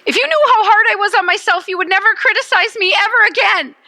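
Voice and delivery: high, loud, fast pleading complaint; exasperated type one. Retold or imitated. Type one protesting to critics, high desperate wail. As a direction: wail it like the self-critical perfectionist. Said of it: "Type ones wanna say".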